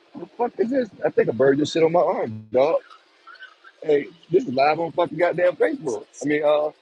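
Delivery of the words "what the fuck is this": squeaky high what-the-hell, much higher; shocked squeal, much higher; disgusted surprise. Real, not acted. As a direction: recoil at the mess on your arm. Second look at the mess.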